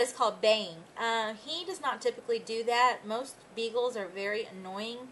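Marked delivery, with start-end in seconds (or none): none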